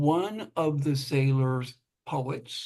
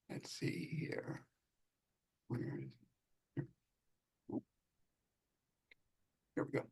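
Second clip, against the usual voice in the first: whispering; 3 semitones lower, 18 dB quieter, faster than usual. Real, not acted. Searching quietly for the file.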